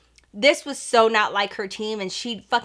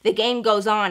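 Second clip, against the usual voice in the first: usual speaking voice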